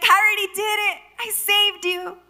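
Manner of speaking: high, loud protest; insistent reply. Acted. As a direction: insist it is already done. God's acted insistent reply.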